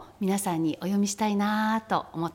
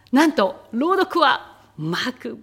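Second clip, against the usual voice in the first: raised, louder big reveal; excited announcement; 6 semitones higher, 8 dB louder than usual. Real, not acted. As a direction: announce it like a surprise guest. Host announcing the reader.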